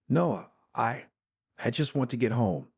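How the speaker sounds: ordinary delivery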